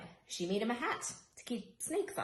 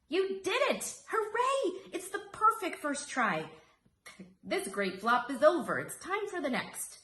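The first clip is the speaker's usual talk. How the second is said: much higher, loud, elated praise; joyful cheering; 8 semitones higher, 4 dB louder than usual. Acted. Great-great-aunt cheers Rosie's first flop, high joyful praise. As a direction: cheer her on with delight.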